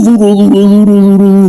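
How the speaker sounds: high loud repeated duro; stubborn emphasis. Real, not acted. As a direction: pound duro, duro like an iron will. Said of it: "yo estoy duro".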